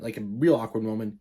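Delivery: ordinary delivery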